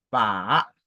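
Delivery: "pa" dipping low, then rising; long low dip on "pa"; third tone. Shown as a demonstration. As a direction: sink on "pa", then swing it upward. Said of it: "le troisième ton".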